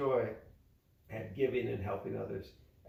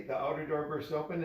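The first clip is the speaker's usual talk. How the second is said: ordinary delivery, nothing marked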